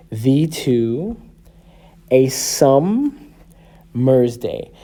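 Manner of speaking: "Thee to a summer's day" very slow, paused every two syllables; separated in pairs. Shown as a demonstration. Teacher separating syllables into five groups, separated in pairs. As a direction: break it as thee to, a sum, mer's day. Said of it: "separate the syllables".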